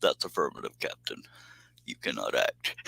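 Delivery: deadpan Vulcan, barely above a murmur; weak Spock. Shown as a demonstration. A self-admitted weak Spock impression for the stream, soft and low. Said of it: "That was a weak Spock".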